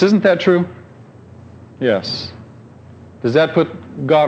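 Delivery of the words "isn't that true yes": ordinary talk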